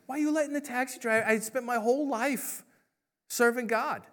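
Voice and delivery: high, fast indignant complaint from the priest; a priest protesting how unfair it is; upset priest. Retold or imitated. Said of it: "and the priest is upset".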